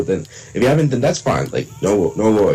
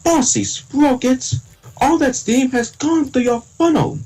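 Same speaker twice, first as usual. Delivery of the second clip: very high, slow Thomas impression; own Thomas voice; slower than usual. Shown as a demonstration. Showing friends own version of Thomas, Thomas voice. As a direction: stretch each word out in a high engine voice. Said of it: "let me try my voice".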